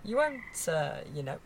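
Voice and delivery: nervously fussing about her standing, lightly voiced; worried posh lady. Q P fretting about the neighbours gossiping, softly posh. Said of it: "I suddenly felt very Penelope Keith".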